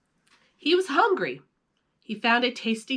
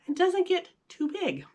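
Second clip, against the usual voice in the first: high, goofy dad voice; father setting a condition, high and goofy; 10 semitones higher than usual. Acted. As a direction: tack on the condition like a cartoon dad. Goofy's voice continues.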